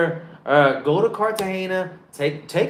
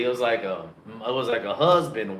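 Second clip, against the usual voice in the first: normal talking voice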